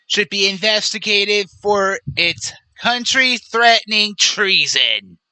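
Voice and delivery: put-on politician impersonation; Donald Trump. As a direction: quote the post in a mock presidential voice. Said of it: "But he continues".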